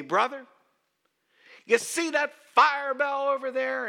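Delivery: loud, high preacher's shout; imitating a preacher who won't tone it down; fiery street preacher. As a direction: challenge him at full volume. Retold street preacher answering back.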